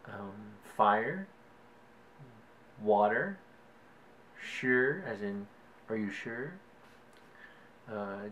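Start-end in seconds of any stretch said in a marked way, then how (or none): none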